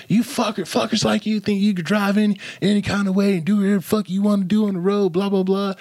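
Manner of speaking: high, mocking road-rage tirade; imitated angry rant. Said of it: "talking hella shit".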